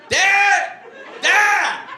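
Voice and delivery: high, loud cry for help; yelling for dad. Retold or imitated. Boy on the bathroom floor yelling for his dad, high and loud. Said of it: "And I'm just yelling".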